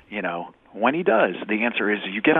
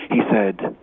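ordinary delivery

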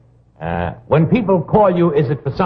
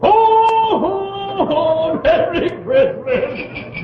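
jolly ho ho ho; 12 semitones higher, much slower than usual. Acted. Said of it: "could I hear the guest say something".

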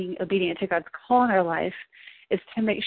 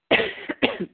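sharp cough mid-sentence. Real, not acted. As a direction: cough once, then apologise. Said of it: "sorry, I'm coughing".

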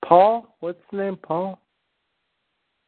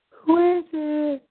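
high, slow "Who is it?"; 12 semitones higher, slower than usual. Pretend. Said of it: "That sounded like [name]".

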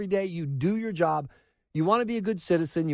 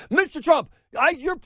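louder, high-pitched mock outburst; Jorge Ramos; 8 semitones higher, 4 dB louder than usual. Reporter jumps up at the press conference, mock indignant shout. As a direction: imitate a reporter jumping up to interrupt. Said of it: "Jorge Ramos jumps up and says".

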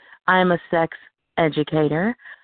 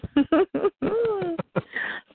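high giggle; 12 semitones higher than usual. Real, not acted. Flattered laugh.

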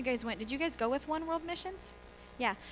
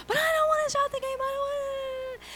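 put-on high, whining little boy protesting; whiny child; 14 semitones higher, 4 dB louder than usual. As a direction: play the sulky son who won't quit his game. Son refusing to stop game.

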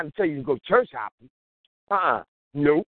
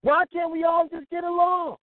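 shouted plea; 7 semitones higher than usual. Retold or imitated. Preacher quoting Rodney King to the crowd, shouted plea. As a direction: belt the famous line out high. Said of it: "Rodney King said it".

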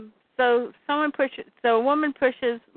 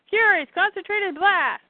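high rallying command; battle cry; 9 semitones higher than usual. Acted. Someone ordering the Furies to fire, battle cry. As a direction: call the attack in a high pitch.

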